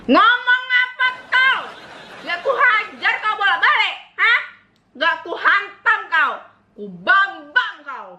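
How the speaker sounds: mock furious dad, dragging each threat out; imitating angry father. Imitating her Batak father scolding her, mock furious bellow. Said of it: "Bisa bapakku kayak gini".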